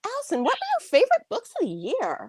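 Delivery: high bright interviewer voice; fake host voice. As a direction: play the chirpy host. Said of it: "so it's always fun to be like".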